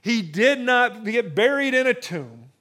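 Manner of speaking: loud, high, rousing sermon build; fervent pulpit crescendo; preaching at full force. Acted. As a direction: preach it, lift the room.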